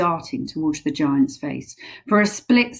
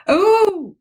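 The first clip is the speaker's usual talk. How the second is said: huge pained giant roar; 7 dB louder than usual. Acted. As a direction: bellow it out in pain. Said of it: "roared the flesh lump eater".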